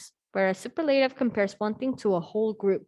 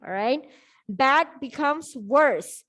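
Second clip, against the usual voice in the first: ordinary talk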